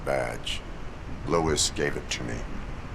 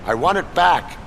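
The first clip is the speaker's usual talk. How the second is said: loud demand; 8 dB louder than usual. Acted. Robot demanding badge back.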